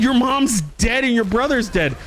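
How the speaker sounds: high, frantic blurting; retold panic. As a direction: blurt it high, re-enacting your alarm. Retells his panicked announcement to girlfriend.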